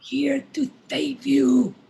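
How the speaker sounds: slow hushed whisper. Acted. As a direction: breathe it slowly. Intruder whispers.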